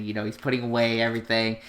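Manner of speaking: usual speaking voice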